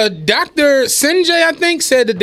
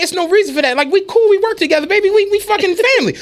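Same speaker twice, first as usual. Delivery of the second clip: higher-pitched appeal to a friend; conciliatory pleading; 5 semitones higher than usual. Retold or imitated. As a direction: plead to smooth things over, voice raised in pitch. Coworker making peace after the quarrel.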